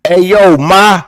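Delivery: high, loud, aggressive yell; mock uppercase New York holler; shouty New York dude. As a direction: bark it like an aggressive Brooklyn guy. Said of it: "as soon as he type in uppercase".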